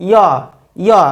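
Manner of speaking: "ইয়" as a y glide, not j. Shown as a demonstration. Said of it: "এর সঠিক উচ্চারণ হবে".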